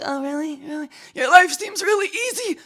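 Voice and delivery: high-pitched sarcastic jab; mock disbelief. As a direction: put on a high, mocking voice. Mocking complaining schoolkids, acted.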